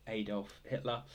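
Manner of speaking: quick put-on American accent, AY-dolf. Shown as a demonstration. Quiz host redoes the answer in an American accent. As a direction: say the name the American way. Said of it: "Gotta say that with an American accent".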